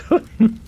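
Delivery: very high, loud cackle; short screechy villain's laugh; cackle of amusement. Acted. Possessing personality amused by the priest, high cackle. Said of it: "with a cackle of amusement".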